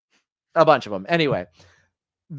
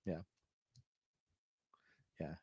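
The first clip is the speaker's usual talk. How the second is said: ordinary talk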